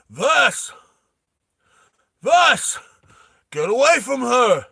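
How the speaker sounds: high, loud warning yells; urgent shouting. Acted. Hammy warning Vas away from Bella, urgent shout. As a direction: yell the name, then the order.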